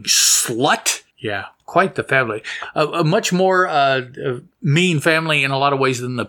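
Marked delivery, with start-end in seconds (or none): none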